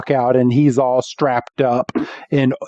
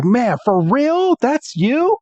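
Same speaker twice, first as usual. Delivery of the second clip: very high, drawn-out imitation of an amazed kid; play-acted incredulous questioning; starstruck disbelief; 10 semitones higher than usual. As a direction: squeak up in mock amazement. Iris amazed by Drayden.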